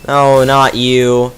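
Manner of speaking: normal talking voice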